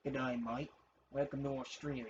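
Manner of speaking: playful put-on Australian accent. Gamer showing off an Australian accent to viewers, playful Australian accent. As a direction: greet them like an Aussie outback guide. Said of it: "That's my Australian accent".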